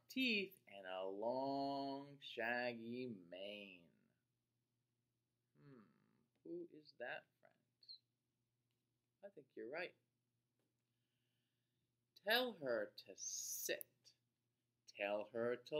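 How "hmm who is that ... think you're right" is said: whispered question. Real, not acted. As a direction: breathe it as a whisper. Whispered aside to kids.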